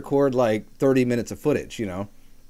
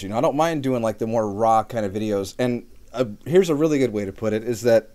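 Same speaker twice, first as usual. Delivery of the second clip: ordinary talk, nothing marked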